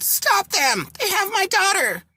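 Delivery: high-pitched alarmed cry; mock yell. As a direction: cry out high, as if calling for help. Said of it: "someone yelled".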